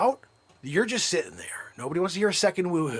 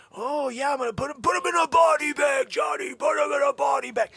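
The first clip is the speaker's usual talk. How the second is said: mock trash-talk shout; 8 semitones higher than usual. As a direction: yell it like a cocky fighter. Retold fight, mock trash talk.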